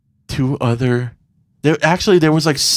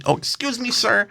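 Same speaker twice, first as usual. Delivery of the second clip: high, meek excuse me, sir; mock timid guy; 9 semitones higher, 4 dB quieter than usual. Imitating the man apologizing to him, high and meek. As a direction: squeak the apology. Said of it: "he does it to me".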